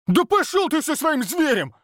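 very high, furious yell; barked. Acted. Oleg snapping at the hunter, furious yell. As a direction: bark it in his face. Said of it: "рявкнул Олег".

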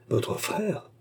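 startled high question, softly; the doctor, startled, asks about her brother with a high, surprised lift; surprised cry. A narrator's reading. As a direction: gasp it out in surprise. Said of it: "s'écria le docteur Louis".

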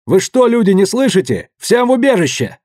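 high, loud urgent yelling; yelling at stragglers to get to shelter; shout. A narrator's reading. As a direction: order everyone to shelter. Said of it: "заорал он".

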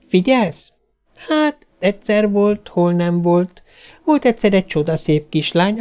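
put-on high child voice telling a fairy tale; storytelling little girl. A narrator's reading. Little girl telling the robot Cinderella, earnest storytelling.

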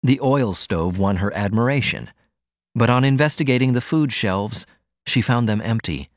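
usual speaking voice

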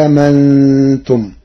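أهامنتم with the second hamza eased toward ha; softened hamza. Shown as a demonstration. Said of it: "بالاستفهام وبتسهيل الهمزة". (أهامنتم بتسهيل الهمزة الثانية)

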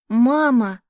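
loud call. A narrator's reading. Son calling mother from the tree, loud call. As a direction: call out to her in alarm.

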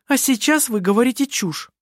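high, indignant interruption; indignant retort; cutting him off. A narrator's reading. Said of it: "оборвала его Керстин".

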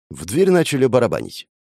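ordinary delivery

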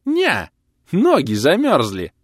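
high, loud, drawn-out punchline; triumphant. A narrator's reading. Tablemate lands his old joke, high and triumphant. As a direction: crow the punchline slowly. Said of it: "торжествующе произнёс сосед".

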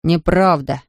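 put-on scornful boy's contradiction; mildly contemptuous denial. A narrator's reading. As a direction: dismiss it with contempt. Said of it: "презрительно откликнулся Шеклтон".

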